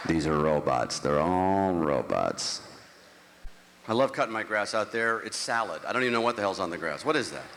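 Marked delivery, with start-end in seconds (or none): 0.1-2.6 slow robotic drone. Acted. Calling LA people robots, slow and low. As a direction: lower and very slow.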